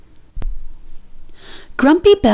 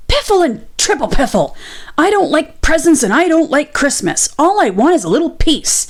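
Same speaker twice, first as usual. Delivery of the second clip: irritated; 6 semitones higher, faster than usual. A narrator's reading. Badger snaps at Squirrel.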